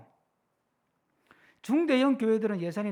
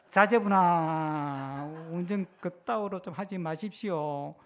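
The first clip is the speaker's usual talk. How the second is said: long drawn-out first word, easing off; mock-polite climbdown; 3 semitones lower, slower than usual. Driver softening a curse midway, comically restrained.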